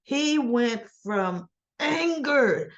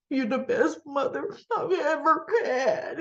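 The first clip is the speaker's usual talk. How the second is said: mock crying Spencer; 4 semitones higher than usual. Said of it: "standing there crying like a dribbling idiot".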